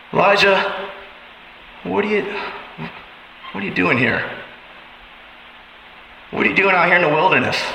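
God gently questions the broken Elijah; gentle questioning. Acted. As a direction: ask it like a concerned friend. Voicing God's question to Elijah.

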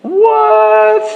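very high, squealed what; mock disbelief. Preacher reacting to Joseph's forgiveness, mock disbelief.

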